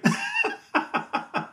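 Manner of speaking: high laugh trailing off; a long amused laugh; high laughter. Real, not acted. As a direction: keep laughing at the story. Keeps laughing after the reply.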